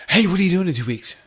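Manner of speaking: high loud eager calling out; mock eager call. Kevin bursting into the teaching studio, loud eager call. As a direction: call in excitedly from the doorway. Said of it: "and he goes".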